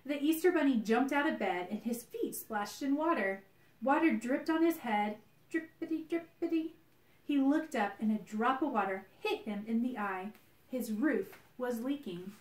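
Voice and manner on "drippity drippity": high, bouncy drippity drippity; playful drip sounds. A narrator's reading. Narrator playing drips for listeners, high and bouncy. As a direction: sing out drippity like falling drops.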